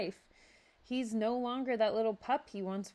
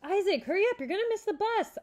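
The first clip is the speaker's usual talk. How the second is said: high, loud call from downstairs; yelling; 13 semitones higher, 6 dB louder than usual. A narrator's reading. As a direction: hurry him up from below. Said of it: "yelled from downstairs".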